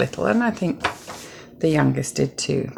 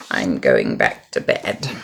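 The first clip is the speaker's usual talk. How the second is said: tired Toad. A narrator's reading.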